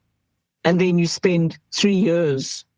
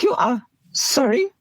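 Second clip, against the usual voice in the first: incredulous; 8 semitones higher than usual. Retold or imitated. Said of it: "I was like".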